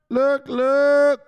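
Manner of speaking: high, loud "Look, look"; loud call. Real, not acted. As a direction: shout for attention. Calling for attention.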